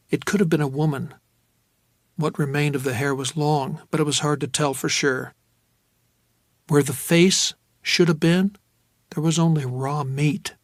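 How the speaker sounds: usual speaking voice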